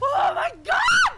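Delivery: high, loud shout of shock; real frightened scream. Real, not acted. Jump scare in the game.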